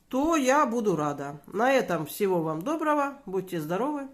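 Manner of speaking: ordinary talk, nothing marked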